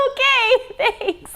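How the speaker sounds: mock thanks through a laugh; laughing sarcasm. Laughing back at grandpa's brush-off, mock grateful. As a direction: giggle a dry okay, thanks.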